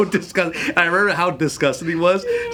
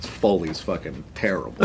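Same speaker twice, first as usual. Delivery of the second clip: gruff dad; 12 semitones lower, 7 dB quieter than usual. Retold or imitated. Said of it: "he's like".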